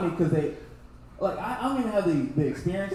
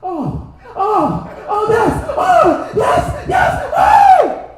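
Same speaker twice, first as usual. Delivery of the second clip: shrill climbing moans, much higher and louder; porn moaning parody; 11 dB louder, much slower than usual. Retold or imitated. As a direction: ham up escalating orgasm cries. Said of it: "sound like they watching a game".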